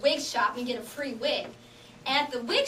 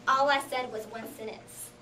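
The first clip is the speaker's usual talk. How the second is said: ordinary talk, nothing marked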